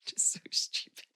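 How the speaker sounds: soft whisper to herself; whispered aside. Real, not acted. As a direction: breathe it out under her breath. Aside about crying again.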